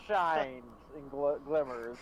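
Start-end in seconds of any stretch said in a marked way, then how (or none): none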